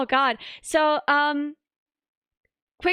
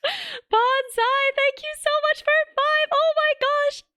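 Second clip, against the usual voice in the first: much higher, slow squealing thank-you; excited thanks; 12 semitones higher, slower than usual. Real, not acted. Streamer squealing thanks to a donor, very high. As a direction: squeal the donor's name with joy.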